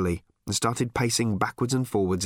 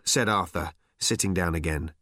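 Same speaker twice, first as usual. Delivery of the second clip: ordinary delivery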